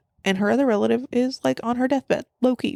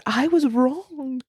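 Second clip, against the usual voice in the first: mock-emotional confession; 5 semitones higher, much slower than usual. Voicing tearful confession to dad.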